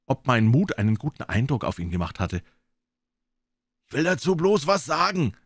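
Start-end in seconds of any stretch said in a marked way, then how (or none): 3.9-5.4 put-on gruff old sailor cutting in, higher; old sailor calling. Old sailor Morgan calls out to the crew. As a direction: call out over the others. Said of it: "rief der alte Matrose".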